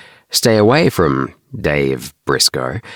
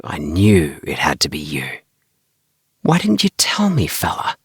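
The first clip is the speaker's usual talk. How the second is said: hushed, excited whisper. A narrator's reading. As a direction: whisper it close so no one hears. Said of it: "Tommy said in a whisper".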